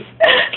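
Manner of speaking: a short, very high burst of laughter. Real, not acted. Narrator laughing at the lucky twist, high squealing laugh. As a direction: squeal with delight.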